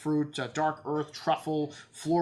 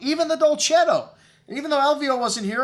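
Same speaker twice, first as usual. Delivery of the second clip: excited emphasis; 11 semitones higher, 9 dB louder than usual. Real, not acted. Surprising praise for a Dolcetto.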